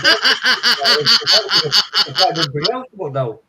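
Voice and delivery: slow, laughing through the words. Real, not acted. Telling his mate about the form, laughing. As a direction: get it out between laughs.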